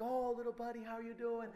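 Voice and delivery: gentle, doting greeting; cooing baby talk. Acted. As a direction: talk sweetly to a tiny baby.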